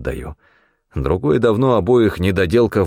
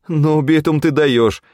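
higher-pitched awed crony voice; admiring sidekick; 8 semitones higher, 3 dB louder, slower than usual. A narrator's reading. Федька praising the hunter's kill, high and admiring. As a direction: gush in a raised voice. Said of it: "не отставал от него Федька".

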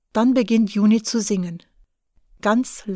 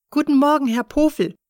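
louder and higher, a bright call across the lobby; loud, higher greeting; said loudly; 5 semitones higher, 4 dB louder, faster than usual. A narrator's reading. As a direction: greet him loudly so Thomas hears. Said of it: "sagt sie laut".

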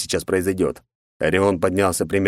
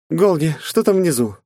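female character voice; 10 semitones higher, 4 dB louder than usual. Acted. Kitsune calling on radio.

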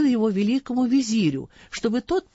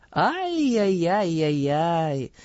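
slow, drawn-out, mock-dismayed ay-yay-yay; tut-tutting exclamation; much slower than usual. A narrator's reading. Caliph dismayed at the false testimony, in slow, drawn-out tutting. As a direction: stretch the ay-yay-yay out like a scolding tut. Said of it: "воскликнул Гарун".

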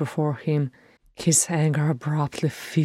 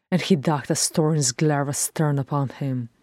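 ordinary delivery, nothing marked

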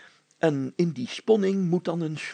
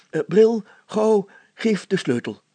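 louder, pressing call for the key; urgent demand; 4 semitones higher, 6 dB louder than usual. A narrator's reading. Mayor urging Bril to hand over the key, louder. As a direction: hurry him, impatient.

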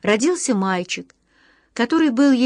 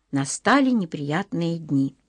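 usual speaking voice

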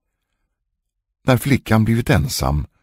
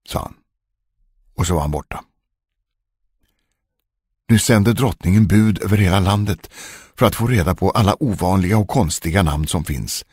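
ordinary delivery, nothing marked

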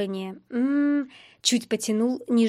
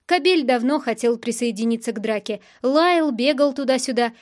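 plain reading voice

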